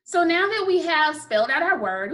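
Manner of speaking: normal talking voice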